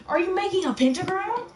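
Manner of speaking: high, loud mock alarm; surprised. Real, not acted. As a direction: gasp it out in shock. Teasing sibling.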